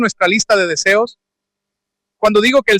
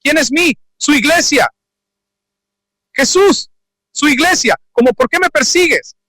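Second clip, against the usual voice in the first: higher, bewildered back-and-forth; acted exchange; 6 semitones higher than usual. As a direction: play Saul confused, Jesus insisting. Acted dialogue Saul and Jesus.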